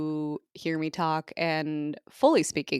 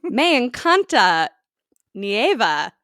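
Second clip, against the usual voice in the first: slow, loud Spanish, pitched higher; hammed-up basic Spanish; mock beginner Spanish; 5 semitones higher, 10 dB louder, slower than usual. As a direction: learner Spanish said with gusto. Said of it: "They'll be like".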